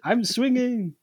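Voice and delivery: put-on high-pitched childlike boast; gleeful play. Ninja showing off on the swings, in high gleeful voice.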